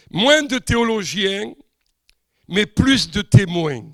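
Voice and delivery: slow, emphatic preaching, each contrast punched; preaching recitation. Acted. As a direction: declaim it like a pulpit reading. Reads text in preaching cadence.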